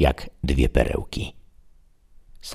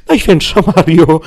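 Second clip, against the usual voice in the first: much higher, louder alarmed cry; Sancho crying out; 9 dB louder than usual. Acted. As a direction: shriek it in horror. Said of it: "krzyknął Sancho".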